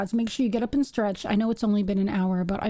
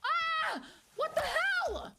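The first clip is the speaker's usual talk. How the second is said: high, startled yell; startled shout; 4 dB quieter, much slower than usual. Real, not acted. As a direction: jump at the sudden sound. Sudden scare in game.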